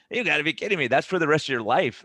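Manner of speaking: kidding me, surely not for life; incredulous. Retold or imitated. Doctor telling his patient, retold disbelief at lifelong medication.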